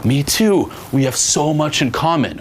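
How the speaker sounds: high-pitched, gushing mock delight; hammed-up excited discovery; overblown enthusiasm. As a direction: act thrilled by a trivial coincidence. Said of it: "you just overblow something that you like".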